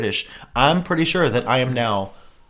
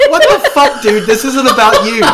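high, loud mock incredulous outburst; mock outrage; 9 semitones higher, 8 dB louder than usual. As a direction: throw an exaggerated what-the-fuck look into the voice. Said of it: "both of us are looking at you like".

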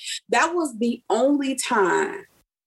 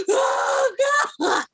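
high gasping cry; hammed-up sobbing shriek; mock wailing. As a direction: wail in comic despair. Re-enacting crying put in car.

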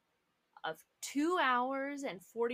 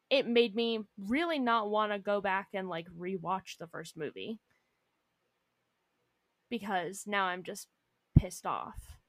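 normal talking voice

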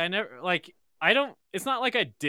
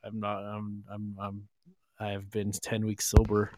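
mumbling sober guest; 3 semitones lower, 8 dB quieter than usual. Retold or imitated. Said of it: "everyone else is like".

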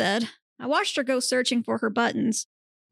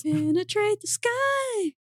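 much higher, slow and drawn out; high dramatic call; 10 semitones higher, slower than usual. Acted. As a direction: declare it grandly, slow and high. Dramatic goofy declaration.

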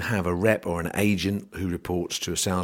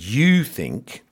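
"you" drawn out slowly and louder; stress on you; 4 semitones higher, 5 dB louder than usual. Shown as a demonstration. To farmers making their own call, stress on "you". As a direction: lean hard on "you", slow it down. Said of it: "I emphasize the word you".

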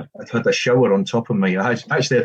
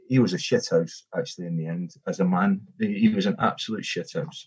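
ordinary talk, nothing marked

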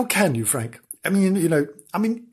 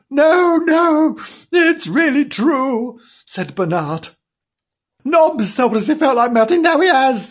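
pleading; 11 semitones higher, 5 dB louder than usual. Acted. Pleading to be believed.

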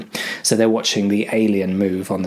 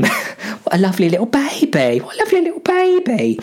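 put-on much higher, cooing over a baby; high doting coo, faster on the repeat; cooing cleaner; 14 semitones higher than usual. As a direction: gush at the little thing. Cleaning lady cooing over alien.

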